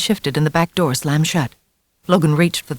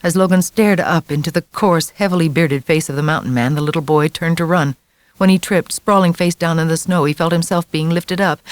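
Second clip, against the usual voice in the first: usual speaking voice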